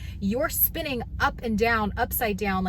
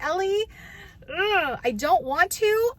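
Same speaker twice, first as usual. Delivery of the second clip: reluctant groan; 9 semitones higher than usual. Retold or imitated. Mom groaning at daughter's ride request.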